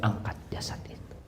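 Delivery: hushed, breathy whisper. Acted. Preacher whispering the Prophet's words over the body. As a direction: let the line fall to a whisper.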